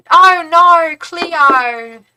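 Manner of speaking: loud, high put-on Australian accent. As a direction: ham up an Australian "no". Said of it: "I can't say yeah in Australian, but I can say no".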